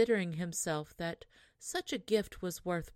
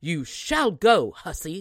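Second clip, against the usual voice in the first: angry mother; 11 dB louder than usual. Acted. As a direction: snap the order at the rude daughter. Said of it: "said the mother".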